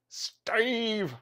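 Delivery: high, drawn-out "Steve!"; playful greeting call. Real, not acted. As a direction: sing out the name in a high-pitched greeting. Greeting viewer who joins stream.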